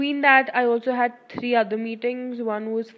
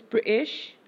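British accent with the T dropped in "British". Shown as a demonstration. Friend showing off the only accented word known, British accent. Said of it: "accented word that I know".

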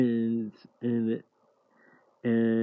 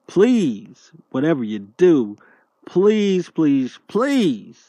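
loud, begging please, high and pressed; urgent pleading; 5 semitones higher, 7 dB louder, much slower than usual. Real, not acted. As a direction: beg them loudly, over and over.

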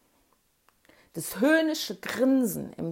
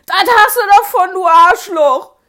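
yelled at full force, much higher and louder; furious shout; 10 semitones higher, 18 dB louder than usual. Retold or imitated. Andi screams at the sinking bully, full shout. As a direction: scream the insult. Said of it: "schrie Andi".

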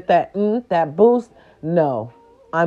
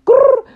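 playful growl; 7 dB louder than usual. Acted. Growl sound, tight hair.

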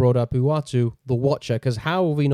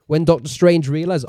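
normal talking voice

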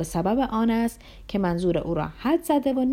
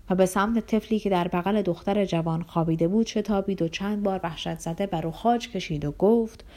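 plain reading voice

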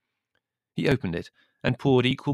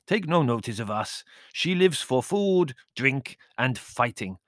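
grinning manservant; 6 semitones higher, 3 dB louder than usual. A narrator's reading. Servant explaining countess to guest, wry and emphatic. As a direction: explain with relish, voice lifting.

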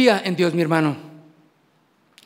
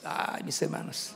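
whispered aside; 9 semitones lower, 15 dB quieter than usual. Real, not acted. Unvoiced aside.

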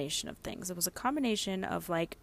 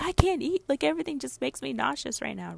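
high protest, loud at first; retold distressed refusal; 8 semitones higher, 4 dB louder than usual. Nauseous wife refusing husband's food advice, high protest. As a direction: protest high, then ease down a little.

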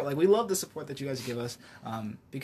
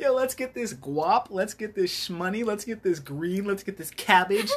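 higher, louder hyped-up slang chant; mock hype; 6 semitones higher, 5 dB louder than usual. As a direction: hype up the crew, playfully hammed-up. Acts out slang for getting money.

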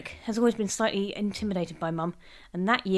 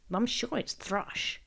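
muttered; 6 dB quieter, much slower than usual. A narrator's reading. Mum stubbornly sticking to her answer, muttering. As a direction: mutter it under your breath. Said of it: "who muttered".

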